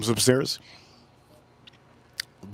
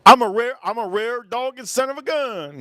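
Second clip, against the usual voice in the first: high, strange put-on voice; 9 semitones higher, 4 dB louder than usual. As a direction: brag it in a squeaky silly character voice. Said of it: "Yes, he is".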